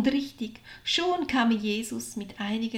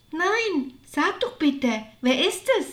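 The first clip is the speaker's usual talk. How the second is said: high, pleading eagerness; eagerly curious; 7 semitones higher, 4 dB louder than usual. A narrator's reading. Maria begs her sister for the name, eagerly curious. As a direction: beg to be told, pitched up. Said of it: "Nun war Maria schon sehr neugierig geworden".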